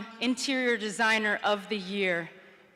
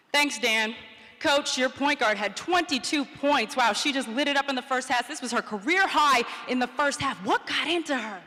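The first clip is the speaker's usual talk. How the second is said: peppy on-air reporter, higher pitched; sideline reporter; 5 semitones higher, 3 dB louder than usual. Retold or imitated. Reporter to coach at halftime, keen on-air interview voice. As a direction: play an eager TV sideline interviewer. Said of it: "sideline reporter is supposed to ask".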